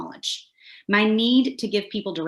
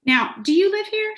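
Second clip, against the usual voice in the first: Southern tourist; 13 semitones higher, 3 dB louder than usual. Acted. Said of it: "she asks".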